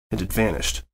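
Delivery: ordinary delivery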